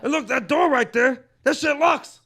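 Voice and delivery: building from talk into a loud, high, laughing rant; Joey Diaz riffing. Retold or imitated. Retelling Joey Diaz roasting an open mic crowd, loud laughing rant. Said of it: "That's a good impression".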